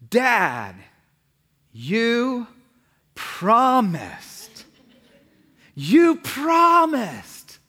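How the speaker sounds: put-on drawn-out child's complaint, building high and loud; pleading kid. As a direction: imitate a kid holding Dad to his word. Acts kids reminding him of promise.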